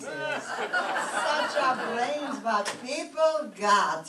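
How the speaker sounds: words breaking into laughter, very slow; slow laughing marvel at people's brains. Acted. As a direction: laugh through it, amazed. Laughing at the telephone.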